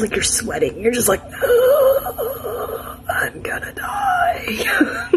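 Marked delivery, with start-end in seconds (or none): 1.3-3.0 high, sharp mock-desperate gasp; hammed-up gasp of distress; dramatic gasp. Retelling the desperate moment. 3.1-5.1 high, slow, whispered wail of mock despair; hammed-up whimpering I'm gonna die. Retelling the desperate moment.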